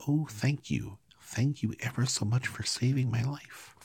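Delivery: high, squeaky grateful mouse; tiny squeaky creature voice; squeaky little voice. A narrator's reading. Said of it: "it said in a squeaky little voice".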